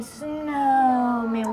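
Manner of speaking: Snowman drawn out so every sound is heard; stretched slowly. Shown as a demonstration. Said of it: "[name]'s saying it slowly again".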